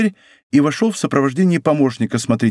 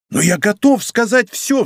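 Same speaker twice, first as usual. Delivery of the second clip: high, drawn-out exclamation; crying out; 7 semitones higher than usual. A narrator's reading. Bonacieux to the commissioner, ready to tell everything, crying out. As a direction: exclaim it eagerly, pitch raised. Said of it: "вскричал Бонасье".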